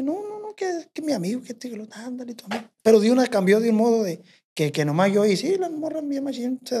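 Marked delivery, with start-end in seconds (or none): 0.0-2.6 imitating the girlfriend. Girlfriend voiced in retold scene.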